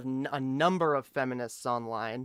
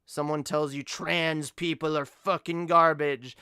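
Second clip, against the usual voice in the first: ordinary talk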